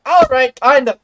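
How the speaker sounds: a cartoonish squeal for her body; voicing her body. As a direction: give her tired body a silly squeak. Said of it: "suddenly body is like".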